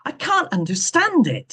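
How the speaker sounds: a touch of defensive protest. Acted. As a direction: protest mildly, as if not his fault. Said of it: "said Peterson defensively".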